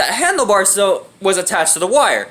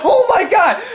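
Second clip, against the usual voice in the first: high-pitched retold shock; shocked exclamation; 11 semitones higher, 3 dB louder than usual. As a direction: act out being caught off guard.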